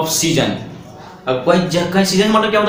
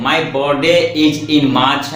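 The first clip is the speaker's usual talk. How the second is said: slow dictation; 2 semitones lower, much slower than usual. Shown as a demonstration. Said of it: "teacher dictates example sentence".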